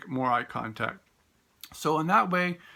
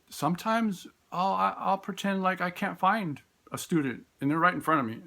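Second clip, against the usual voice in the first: normal talking voice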